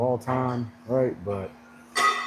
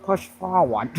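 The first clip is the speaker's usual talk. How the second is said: higher and louder Southern coach; imitating a Southern drawl; 7 semitones higher, 5 dB louder, slower than usual. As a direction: ham up the drawl, pushing you on. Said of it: "He was like".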